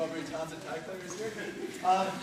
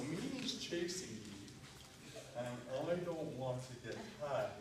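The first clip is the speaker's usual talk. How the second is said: slow motion; 5 semitones lower, 7 dB quieter than usual. Shown as a demonstration. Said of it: "We're doing this in slow motion".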